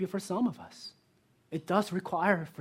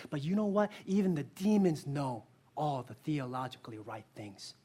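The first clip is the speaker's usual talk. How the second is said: ordinary talk, nothing marked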